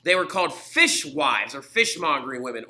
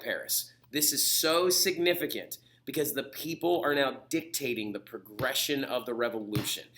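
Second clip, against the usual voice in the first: ordinary delivery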